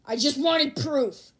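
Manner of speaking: slow, high, loud whine; whiny plea. Acted. Kaminari complaining he wanted proof, whiny. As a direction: whine it like a kid. Character pleading.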